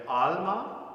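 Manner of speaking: "alma" with the weight on AL; demonstrated stress on AL in "alma"; first-syllable stress. Shown as a demonstration. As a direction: lean on AL, lighten the "ma" in "alma". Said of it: "it's the first syllable".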